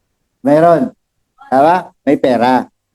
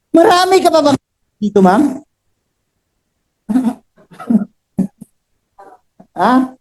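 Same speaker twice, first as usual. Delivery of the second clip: ordinary delivery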